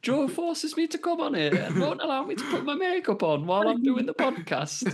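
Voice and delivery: high, laughing put-on Newcastle accent; a squeaky, giggly Newcastle accent. Imitating Rosanna complaining about the podcast, Newcastle accent. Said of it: "that's my Newcastle accent".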